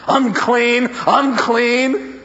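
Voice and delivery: high leper's warning cry; warning yell. Acted. Leper warns those who come near, yelling high. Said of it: "yell out at them".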